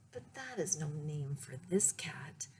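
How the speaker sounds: ordinary delivery, nothing marked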